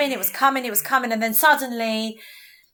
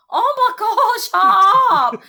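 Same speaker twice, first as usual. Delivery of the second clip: put-on much higher, louder Greek Cypriot accent exclamation; Greek accent burst; 9 semitones higher, 7 dB louder, much slower than usual. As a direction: slip into a Greek accent, squealing "shut up!". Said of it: "sometimes I do become quite Greek".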